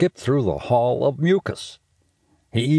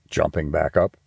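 plain reading voice

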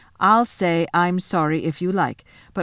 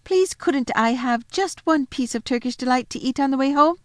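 pleading; 7 semitones higher, 3 dB louder than usual. A narrator's reading. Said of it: "said Edmund suddenly".

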